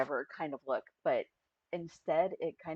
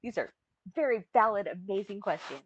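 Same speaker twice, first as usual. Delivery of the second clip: defiant reply; 5 semitones higher, 9 dB louder than usual. Retold or imitated. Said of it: "Be like".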